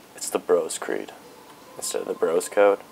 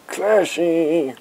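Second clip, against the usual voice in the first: high, loud, drawn-out cheer; hammed-up praise; mock exclamation; 9 semitones higher, 5 dB louder than usual. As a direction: belt it out in a silly high voice. Mock exclamation about Ezio.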